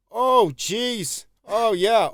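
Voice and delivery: high, put-on old geezer sighing oh jeez; old man voice. Imitating late friend's old-man habit, high old geezer. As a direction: peer over glasses like a grandpa. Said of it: "He's like".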